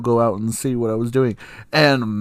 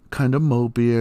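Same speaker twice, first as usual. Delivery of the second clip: ordinary talk, nothing marked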